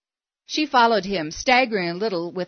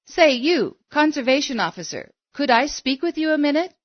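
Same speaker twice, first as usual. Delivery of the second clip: lightly voiced woman, pitched up to get attention; insistent; 7 semitones higher than usual. A narrator's reading. Woman calling to the officer who ignores her, insistent. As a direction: press him for a word in a higher voice.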